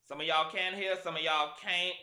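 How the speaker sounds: emphatic and loud. Real, not acted. Loud insistence.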